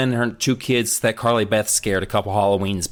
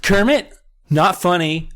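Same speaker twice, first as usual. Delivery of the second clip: scolding sister; 7 semitones higher than usual. Retold or imitated. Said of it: "she comes in".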